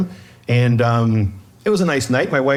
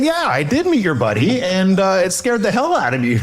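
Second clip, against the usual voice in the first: high-pitched, emphatic retold complaint; mock indignant; 8 semitones higher than usual. Homeowner tells neighbor about the scare, mock indignant.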